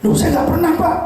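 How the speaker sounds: retold startled protest; mild shocked denial. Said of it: "Saya kaget".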